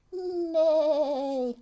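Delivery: giggly horse whinny; playful whinny for the kids; laughing neigh. Pretend.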